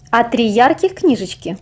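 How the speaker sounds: ordinary delivery, nothing marked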